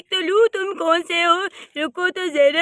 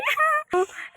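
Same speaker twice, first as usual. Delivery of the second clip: high cackling laugh at the aunt's plan; a high gleeful cackle; 11 semitones higher, 4 dB quieter than usual. Acted. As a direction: burst of scheming laughter.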